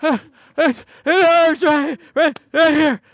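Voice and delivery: high, slow, hammed-up childish whimpering; whimpering little girl. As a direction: overplay a child's pretend pain. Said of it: "Little Gracie comes up".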